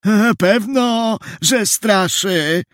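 put-on high, slow old man's call; drawn-out, high-pitched elderly voice. A narrator's reading. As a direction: draw it out like a stooped old man crying out. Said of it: "zawołał stary, przygarbiony człowiek".

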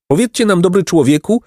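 high, mildly tremulous plea; trembling voice. A narrator's reading. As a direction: ask timidly, pitched well up. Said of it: "zapytał drżącym głosem nieborak".

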